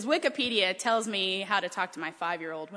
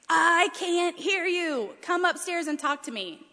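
calling out; 6 semitones higher, 3 dB louder, slower than usual. Retold or imitated. Calling from shower to downstairs.

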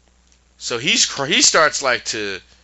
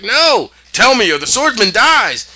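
raised, shouted demand; high loud threat; 11 semitones higher, 6 dB louder than usual. Retold or imitated. Character threatening the guard, high and loud. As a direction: yell the threat. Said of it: "She's like".

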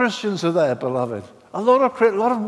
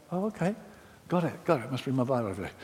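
softer, submissive murmur; a new believer meekly giving in; subdued yes-man reply; meek compliance; 5 semitones lower, 10 dB quieter, much faster than usual. Retold or imitated. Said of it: "someone came to me and said".

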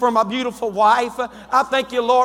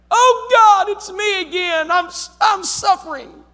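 whiny complainer; 6 semitones higher than usual. Retold or imitated. Mocking a whiny believer to the church. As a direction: act out a whiny prayer. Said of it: "whiny prayer acted out".

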